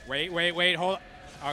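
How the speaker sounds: loud, urgent wait; urgent shout. Real, not acted. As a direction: shout to stop. Tense boss moment.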